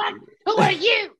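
high, loud disbelief; incredulous shout. Real, not acted. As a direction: yell it in outrage. Outraged rhetorical question.

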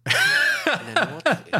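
echoed very high and drawn out with a laugh; mock disbelief. Real, not acted. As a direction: repeat it slowly, high and incredulous, laughing. Ironisches Echo einer Ausrede. (ungläubig, sehr hoch und gedehnt, lachend)

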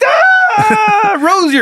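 very high, hammed-up shriek of relief; overjoyed owner. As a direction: shriek the lost dog's name in mock joy. Owner sees his lost dog again.

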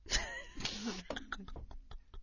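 high helpless laugh; real laughter. Real, not acted. Host collapsing in laughter at her own story, helpless.

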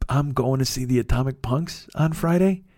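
normal talking voice

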